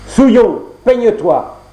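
claque le mot plus haut et plus fort; ordre méprisant. Acted. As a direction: ordonne avec dégoût. Le prince ordonne à la créature. (le fils du roi commande à la créature, sèchement et fort)

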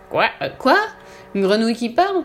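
loud, high rising disbelief; astonished. A narrator's reading. Ogre hearing his swallowed frog talk, astonished. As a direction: gasp out the baffled question.

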